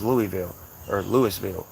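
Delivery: Louisville as LOO-ee-vill, then LOO-iss-vill; outsider pronunciations. Shown as a demonstration. Summing up outsiders' versions, Louisville as LOO-ee-vill or LOO-iss-vill. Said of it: "everybody that's not from here says".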